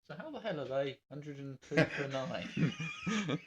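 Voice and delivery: slow, laughing disbelief. Real, not acted. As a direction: laugh through the question.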